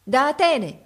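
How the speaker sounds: high, loud shout across the water; shouted reply. A narrator's reading. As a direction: call it out to the giant. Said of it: "gridò il pilota".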